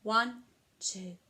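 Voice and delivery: usual speaking voice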